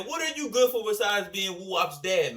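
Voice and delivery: ordinary talk, nothing marked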